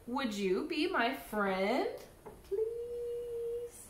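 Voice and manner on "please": pleading please. Acted. Begging the silent stranger.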